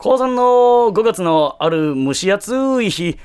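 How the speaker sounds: hushed creepy ghost story. Acted. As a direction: tell it like a horror storyteller. Ghost story narration parody.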